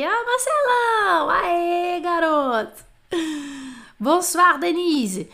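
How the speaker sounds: high, stretched cheerful welcome; excited greeting. Real, not acted. Greeting a viewer who arrives.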